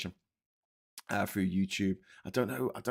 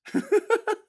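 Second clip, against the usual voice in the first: high laugh; 7 dB louder than usual. Real, not acted. Laughs after Aussie comment.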